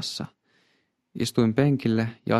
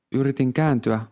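ordinary delivery, nothing marked

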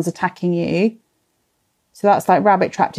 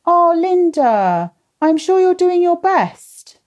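higher, slow, tender reassurance; speaking gently to a struggling mum, higher and slow; reassuring; 9 semitones higher, slower than usual. Real, not acted. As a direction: soothe Linda like a worried friend. Comforting a viewer named Linda.

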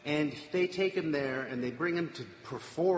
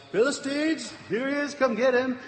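high, raised mock yell; retold yell; 10 semitones higher, 4 dB louder than usual. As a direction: call out high to summon men waiting nearby. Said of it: "then she yells".

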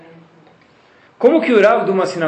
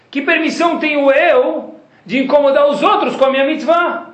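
mock indignation; 10 semitones higher, 5 dB louder than usual. Mocking a loud praying passenger.

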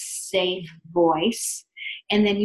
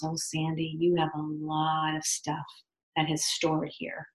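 gentle healer voice; 4 semitones lower, 8 dB quieter than usual. Retold or imitated. Voicing the calm acupuncturist.

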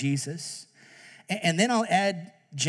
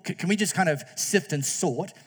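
ordinary talk

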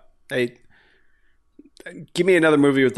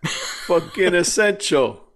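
slow, higher-pitched playful grumbling; mock resentment; 6 semitones higher, 3 dB louder, slower than usual. Podcaster teasing a co-host who still has work, mock resentment. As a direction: jeer with drawn-out mock envy. Mock envy of friend with job.

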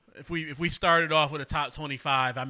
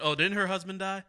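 ordinary talk

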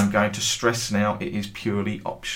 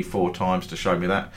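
ordinary talk, nothing marked

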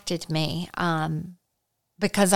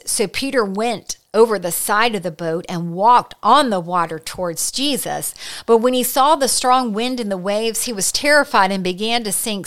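louder, building storytelling read; dramatic scripture reading; 3 semitones higher, 5 dB louder than usual. Acted. Preacher reading Peter's rescue, building drama. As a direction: read it as the storm rises.

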